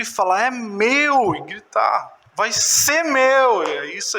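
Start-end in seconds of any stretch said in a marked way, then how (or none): 0.4-1.3 high, drawn-out claim of ownership; claiming cry. Shown as a demonstration. Preacher shows church how to claim it, raised cry. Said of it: "Gritar é a gente realmente acreditar". 2.3-3.6 high, slow, stretched-out cry; claiming shout. Shown as a demonstration. Preacher shows church what shouting means, drawn-out cry. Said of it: "é gritar".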